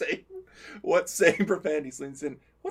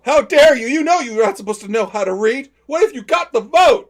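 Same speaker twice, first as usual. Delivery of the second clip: high, loud mock fury; hammed-up outrage; 9 semitones higher, 15 dB louder than usual. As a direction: rant like a cartoon bigot. Mock sexist outrage at daughter.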